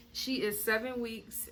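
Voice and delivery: usual speaking voice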